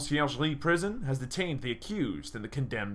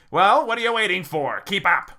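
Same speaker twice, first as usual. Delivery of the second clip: loud, pushy guard voice; impatient guard; 6 semitones higher, 10 dB louder than usual. Acted. Guard ordering the visitors to keep up, loud and impatient. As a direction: hurry them along sharply.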